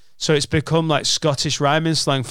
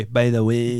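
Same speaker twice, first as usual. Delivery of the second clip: put-on Glaswegian accent; 3 semitones lower than usual. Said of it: "That's a fucking incredible accent".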